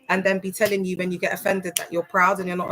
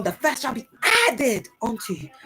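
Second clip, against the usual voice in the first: first pulled out and leaned on, preacher style; preacher stress on first; 3 semitones higher than usual. Retold or imitated. Mimicking the voice thing preachers do on the verse. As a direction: lean hard on the word first like a pulpit voice. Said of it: "They emphasize on".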